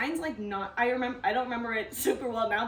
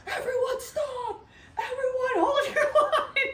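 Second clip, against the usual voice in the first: high, loud mock command, laughing through it; hammed-up guard bellowing at the whole line; guard yelling orders; 13 semitones higher, 3 dB louder, slower than usual. Guard halts all the cars.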